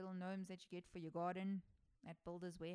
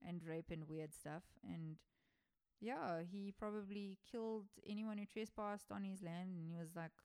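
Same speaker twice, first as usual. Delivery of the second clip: normal talking voice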